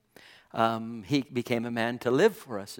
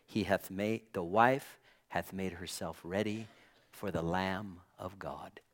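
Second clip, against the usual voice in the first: softer and fading into a whisper; hushed scripture; 5 semitones lower, 8 dB quieter than usual. Shown as a demonstration. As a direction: breathe the verse out reverently. Quiet scripture quote, ends whispered.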